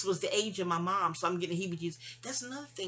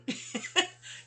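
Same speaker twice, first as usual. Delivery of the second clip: short laugh; 11 semitones higher than usual. Real, not acted. Laughs at own reading booking.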